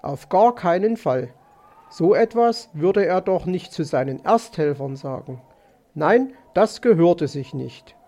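usual speaking voice

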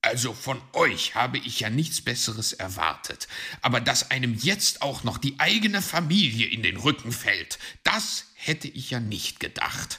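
loud, indignant reproach; put-out uncle, loudly affronted; disgruntled. A narrator's reading. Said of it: "sah ihn missmutig an".

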